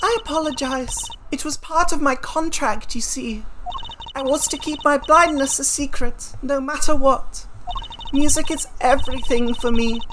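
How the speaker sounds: measured, regretful formal voice; poised singer excusing her deception; composed witness, apologetic. Acted. Witness apologizes to the judge, regretful.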